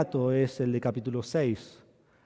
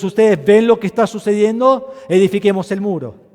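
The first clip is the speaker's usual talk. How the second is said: higher and louder rallying cry; Nehemiah rallying; 7 semitones higher, 13 dB louder than usual. Retold or imitated. Preacher voicing Nehemiah urging the people to build, loud. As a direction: rouse them to build the wall. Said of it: "Y luego le dice".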